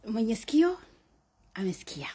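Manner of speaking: proverb fading to a whisper. Real, not acted. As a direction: let the proverb sink into a whisper. Swahili proverb, dropping to whisper.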